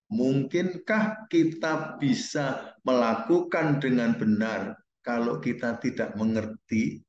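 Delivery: spaced out word by word; measured teacher's question, each word given room; slow, deliberate question. Real, not acted. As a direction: pose the question very slowly and deliberately. Teacher restating key question slowly.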